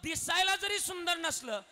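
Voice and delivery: high, loud moral lesson. Acted. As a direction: drive the point home to the audience. Kirtan preaching, raised voice.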